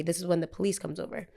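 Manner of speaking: normal talking voice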